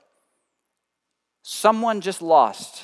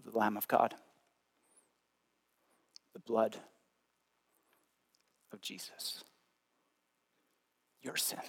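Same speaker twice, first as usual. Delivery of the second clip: hushed preaching; 4 semitones lower, 5 dB quieter than usual. Acted.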